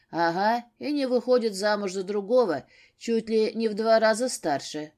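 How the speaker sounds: ordinary delivery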